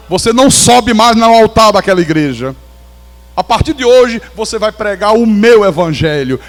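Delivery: loud, commanding divine decree; a preacher hammering God's words out loud; booming voice of God. Retold or imitated. God's command rising to full force.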